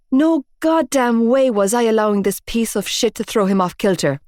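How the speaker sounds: usual speaking voice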